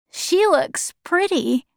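put-on high, slow child's voice; subdued, high childlike voice; sad young boy. A narrator's reading. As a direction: speak as a small mourning boy.

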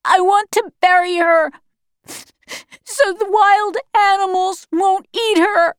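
high, tearful insistence; distraught child's wail; crying boy. A narrator's reading. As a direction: plead through sobs as a grieving child. Grieving boy begs to bury mother.

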